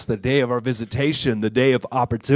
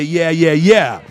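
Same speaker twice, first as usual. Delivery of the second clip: prompting the crowd; 3 semitones higher, 8 dB louder, slower than usual. Shown as a demonstration. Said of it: "a good place for somebody to say".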